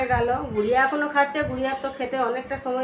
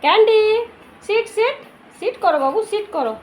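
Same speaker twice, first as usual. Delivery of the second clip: talking to a pet; 8 semitones higher, 10 dB louder than usual. Real, not acted. Feeding and commanding the pet dog.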